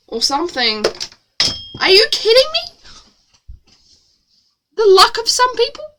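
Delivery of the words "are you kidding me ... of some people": high-pitched incredulous outburst; mock disbelief. Real, not acted. Reader reacting to the story's luck, incredulous. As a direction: scoff in high, exaggerated disbelief.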